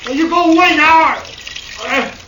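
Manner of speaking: frightened shouting. Acted. Cornered man yells from house.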